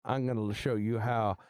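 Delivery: usual speaking voice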